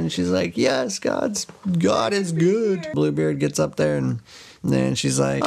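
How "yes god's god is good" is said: high, drawn-out thanksgiving; hammed-up jubilant relief; mock relief. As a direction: rejoice in a raised, slowed voice. Wife rejoices at rescue.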